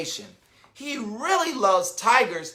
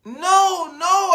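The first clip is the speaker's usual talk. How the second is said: insisting; 9 semitones higher, 5 dB louder than usual. A narrator's reading. Boy insisting in story.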